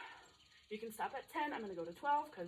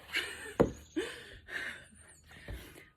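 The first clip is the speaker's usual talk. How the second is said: high breathless laughing; 12 semitones higher, 5 dB louder than usual. Real, not acted. Laughs after the mock cry.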